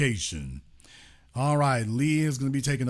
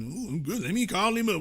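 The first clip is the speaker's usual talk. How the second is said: mock high; 4 semitones higher, 3 dB quieter than usual. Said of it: "They be pouring they lean".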